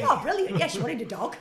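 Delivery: high, loud, laughing mock delight; delighted parent. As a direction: gush like a pleased parent. Parent replying to seller.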